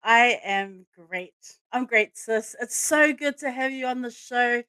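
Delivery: normal talking voice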